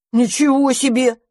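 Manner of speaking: indignant. Acted. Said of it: "возмутился мышевраг".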